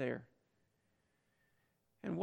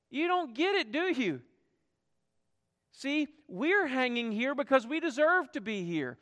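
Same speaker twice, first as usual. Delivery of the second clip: high, urgent rebuke; rebuking friend; 5 semitones higher than usual. Acted. Criminal rebuking the mocking criminal beside him, high urgent rebuke. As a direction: shake sense into his friend. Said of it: "the other rebuked him".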